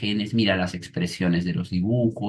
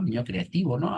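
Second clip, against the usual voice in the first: ordinary talk, nothing marked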